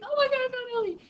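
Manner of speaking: high, overjoyed cry of relief; found her. Retold or imitated. Owner finding her lost dog, high relieved joy. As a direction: burst out with teary joy. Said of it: "started crying a little bit".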